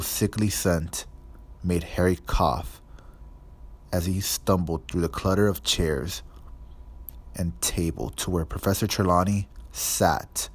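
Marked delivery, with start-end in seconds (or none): none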